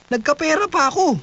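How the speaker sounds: sudden high squeak on the payoff; squeaky high. Acted. Narrator squeaks up at the payoff line. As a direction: jump way up into a squeak.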